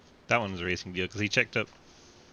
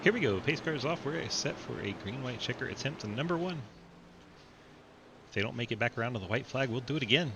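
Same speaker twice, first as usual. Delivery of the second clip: race announcer; 5 semitones higher than usual. Acted.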